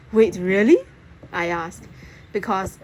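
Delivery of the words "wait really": surprised. A narrator's reading. Surprised by the offer.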